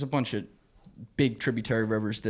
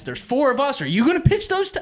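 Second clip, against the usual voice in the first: mock-angry rant, higher and louder; imitating an annoyed guide scolding; getting mad; 13 semitones higher, 6 dB louder than usual. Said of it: "Paul starts getting mad".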